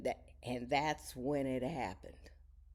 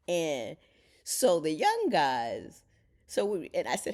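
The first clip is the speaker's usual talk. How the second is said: ordinary talk, nothing marked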